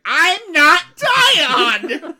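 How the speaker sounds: high, loud, slow shriek, laughing through it; hammed-up bratty king wail; mock Joffrey screeching at his grandfather; Joffrey tantrum. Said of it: "And he's like".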